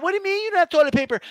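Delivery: high, fast and whiny, a demanding lady; entitled lady. Retold or imitated. A short-haired lady complaining to a cashier, high and whiny. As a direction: rattle it off like an entitled shopper. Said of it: "with short hair and shit".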